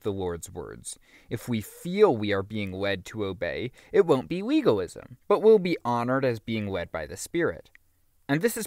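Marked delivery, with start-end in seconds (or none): none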